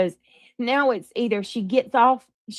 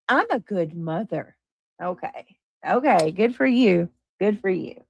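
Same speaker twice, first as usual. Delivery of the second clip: normal talking voice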